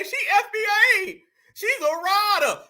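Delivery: high, drawn-out mock cheerleading; mocking her supporters. Host mimics folks who were cheering for her, mocking. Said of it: "folks was trying to say".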